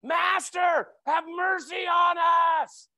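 high, loud, drawn-out plea for mercy; loud cry from afar. Retold or imitated. Lepers calling to Jesus from far off, loud plea. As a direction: call out across the distance, begging. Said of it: "they're yelling to get his attention".

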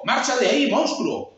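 loud, high frightened yell; frightened hunchback yelling at the monster; scared shout. Acted. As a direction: drive the monster away in fright. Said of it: "comenzó a asustarse".

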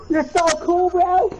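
high slow mocking sneer; sarcastic mockery. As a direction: tease him, drawn out. Mocking an opponent's loadout.

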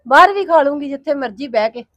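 usual speaking voice

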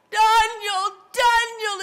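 high, loud anguished calling; lamentable cry. Acted. King crying out at the lion's den, lamenting. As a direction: call out the name in acted distress. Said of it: "he cried with a lamentable voice".

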